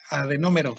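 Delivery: adenómero with a clear stressed NÓ, falling at the end; said as written. Shown as a demonstration. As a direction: model adenómero for a student, accent on the first o. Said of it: "así como se escucha tal cual".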